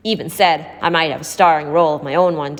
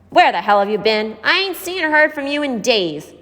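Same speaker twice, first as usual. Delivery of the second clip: raised, much higher accusing demand; angry accusation; 7 semitones higher than usual. A narrator's reading. Lonnie confronting Marcy at her door, angry and raised. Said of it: "he had accused".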